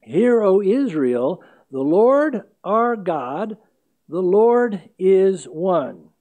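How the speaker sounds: loud, slow, chanted Shema; solemn recitation. Acted. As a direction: intone it like a morning prayer. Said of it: "That first word is Shema".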